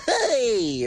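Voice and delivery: high, bright mock "Hey"; excited greeting. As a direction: imitate an over-animated host. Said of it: "You were gonna be like".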